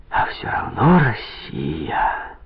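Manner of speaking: slow, breathless whisper. A narrator's reading. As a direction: breathe it out in wonder. Joyful whispered inner exclamation.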